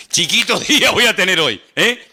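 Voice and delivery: laughing through "Chiquito día voy a tener hoy". Real, not acted. Preacher laughing at the believer's confident boast. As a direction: chuckle as you repeat it.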